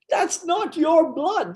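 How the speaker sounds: slow, very high mock exclamation; high-pitched repeat. Storyteller repeating the nurse's revelation, high and slow in mock disbelief.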